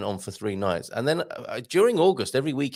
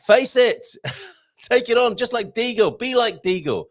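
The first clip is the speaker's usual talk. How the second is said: mock pep talk; 11 semitones higher, 5 dB louder than usual. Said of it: "pep talk to learners".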